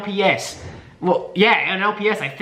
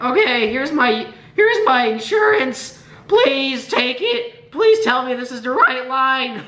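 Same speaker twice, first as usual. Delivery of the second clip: high, slow cracking preteen voice; awkward squeaky voice cracks; 7 semitones higher, slower than usual. Acted. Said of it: "I'm doing this fucking voice crack on purpose".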